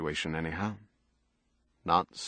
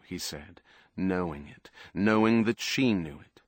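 ordinary delivery

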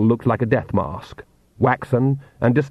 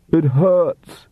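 raised, pained cry; mild shout; 5 semitones higher, 3 dB louder than usual. A narrator's reading. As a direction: call it out higher, in pain. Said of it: "he shouted".

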